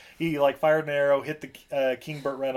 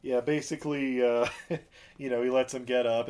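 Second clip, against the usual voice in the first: ordinary talk, nothing marked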